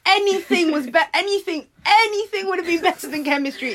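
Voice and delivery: high, loud insistence; emphatic. Real, not acted. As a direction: insist with rising exasperation. Emphatic complaint.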